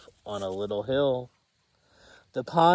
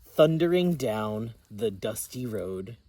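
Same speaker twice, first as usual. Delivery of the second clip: ordinary delivery, nothing marked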